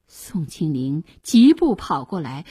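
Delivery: usual speaking voice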